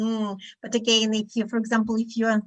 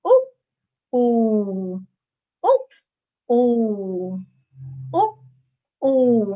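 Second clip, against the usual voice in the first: louder, playful cuckoo ooh and oop; cuckoo calls; 4 dB louder, much slower than usual. Pretend. Teacher leading the class in cuckoo calls. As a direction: call out like a cuckoo clock. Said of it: "we pretend to be a cuckoo".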